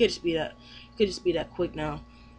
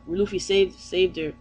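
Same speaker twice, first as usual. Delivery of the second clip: ordinary delivery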